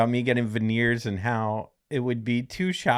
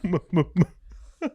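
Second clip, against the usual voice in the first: laughing mock stutter; 5 semitones higher, much slower than usual.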